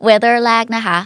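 ordinary delivery

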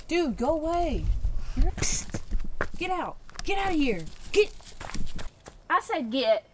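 high, loud shooing an animal. Real, not acted. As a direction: shoo it away firmly. Shooing armadillo.

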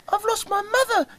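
very high chick voice; tiny lost chick; cheeping chick. Acted. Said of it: "cheeped little chick".

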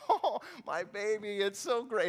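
a hint of a doting old lady. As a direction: play the sweet old mother. Old lady voiced in story.

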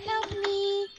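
put-on high, breathy horror-movie girl; creepy little girl. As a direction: plead like a ghost child from The Ring. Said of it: "I sound like a creepy little girl".

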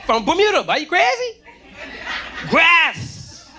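bluffing bravado. Retold or imitated. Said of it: "I was like".

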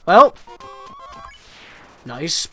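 ordinary talk

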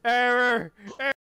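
stiff mechanical error announcement; evenly held robot tones; malfunctioning robot. Pretend. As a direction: play a computer crashing. Robot malfunction bit resumes.

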